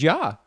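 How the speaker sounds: "Yah" said with a Y sound, never a J; Hebrew pronunciation. Shown as a demonstration. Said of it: "there is no J in the Hebrew language".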